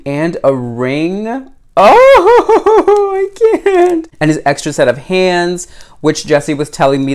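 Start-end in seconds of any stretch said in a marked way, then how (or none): 1.8-4.1 high, loud squeal through a laugh; overwhelmed giggle of joy. Real, not acted. Finds the tiny ring.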